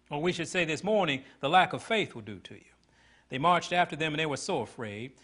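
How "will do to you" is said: whisper. Acted. Pointed aside to congregation.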